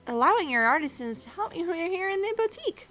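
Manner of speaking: high-pitched, gushing character voice; perky boutique helper. Acted. Boutique worker thanking a ruler, perky high voice. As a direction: pitch it up and gush gratefully.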